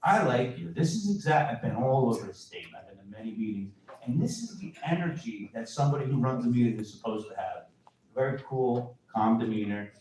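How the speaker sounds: ordinary delivery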